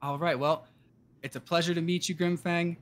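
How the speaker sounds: normal talking voice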